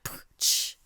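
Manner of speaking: single popped puff into a hiss; imitated squelch. Retelling the eye-digging, with an imitated squelch. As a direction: make a gross squelch with the mouth. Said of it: "making these gross squelching".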